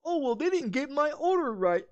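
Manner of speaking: mock whiner. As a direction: whine it like a fussy customer. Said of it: "they bitch and complain about".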